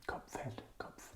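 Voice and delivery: very quiet, near whisper; hushed repetition. Shown as a demonstration. Teacher softly repeats the new word for head, hushed. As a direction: murmur the vocabulary under your breath.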